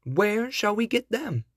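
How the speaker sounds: high-pitched, impatient storybook question; impatient, lifted-voice query; impatient godmother. A narrator's reading. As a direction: pitch it well up, as a fairy godmother wanting an answer now. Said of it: "cried the godmother impatiently".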